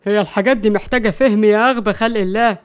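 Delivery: put-on voice with a touch of irritation; mildly fed up, insulting a fool; exasperated. A narrator's reading. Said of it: "مروان قال بغيظ". (بغيظ خفيف)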